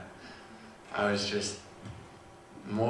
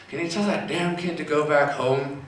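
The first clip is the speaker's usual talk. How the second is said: higher, louder irritated neighbor; irritated complaint; 7 semitones higher, 6 dB louder than usual. Retold or imitated. Husband complains from the back of the house, irritated. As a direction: imitate an annoyed husband calling out.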